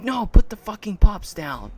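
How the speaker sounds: imitated angry demand. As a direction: yell it like the furious customer. Mimics rival's angry demand.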